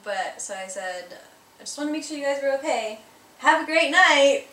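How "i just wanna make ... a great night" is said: higher, fast cheery American send-off, louder at the end. Retold or imitated. Woman bidding the French strangers goodnight, chirpy American voice. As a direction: play it like an overly chirpy American neighbour. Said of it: "the most embarrassing, stereotypical American thing".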